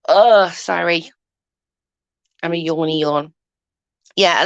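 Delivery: usual speaking voice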